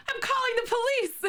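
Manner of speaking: high, squealing mock panic; mock alarmed squeal. Shown as a demonstration. Streamer replaying her clip, squealing in mock panic. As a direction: shriek it like a fake emergency. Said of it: "There's two versions".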